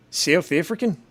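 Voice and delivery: badly faked South African accent, rising in question; shaky stab at a South African accent; South African accent attempt. Shown as a demonstration. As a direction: try a South African accent that slips towards Australian. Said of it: "it just sounds more Australian".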